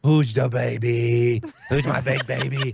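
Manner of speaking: put-on cooing baby talk; baby talk to dog. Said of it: "like I'm talking to a baby".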